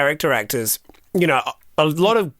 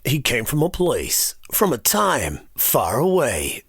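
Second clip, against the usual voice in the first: movie trailer voice; 3 semitones lower, slower than usual. Shown as a demonstration. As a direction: draw it out like a film trailer announcer. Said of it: "So that my lead up is".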